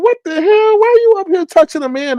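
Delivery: high-pitched comic indignation; incredulous acted complaint; mock outrage. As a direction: demand an explanation, voice shooting up. Retold outrage at being touched.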